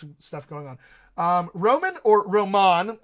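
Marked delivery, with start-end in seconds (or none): 1.5-2.0 "Roman" said ROH-mən, stress on the first syllable; Anglicised name. Shown as a demonstration. Coach reading a viewer's name, Roman as ROH-mən. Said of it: "not sure which one it is". 2.3-3.0 "Roman" said roh-MAHN, stress on the second syllable; foreign-style name. Shown as a demonstration. Coach unsure of a viewer's name, Roman as roh-MAHN. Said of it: "not sure which one it is".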